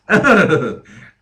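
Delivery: sudden loud laugh. Real, not acted. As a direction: burst out in a short, loud laugh. Laughs at own explanation.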